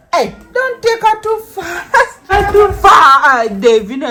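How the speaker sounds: loud, high-pitched calling. Real, not acted. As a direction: call out a protest to someone walking off. Calls out as Davina walks baby.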